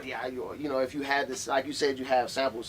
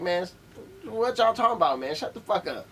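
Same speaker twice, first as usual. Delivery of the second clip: higher, louder fed-up retort; mock exasperation; 7 semitones higher, 4 dB louder than usual. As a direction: act out being sick of everyone's talk. Voices an artist's fed-up retort.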